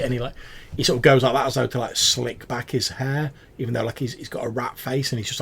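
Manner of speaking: usual speaking voice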